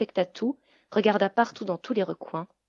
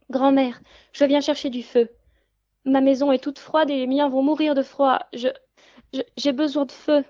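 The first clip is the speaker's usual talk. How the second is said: put-on higher, louder pleading girl; trembling girl; 6 semitones higher, 6 dB louder than usual. A narrator's reading. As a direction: plead timidly like a scared child. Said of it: "Toute tremblante". (petite fille tremblante qui supplie)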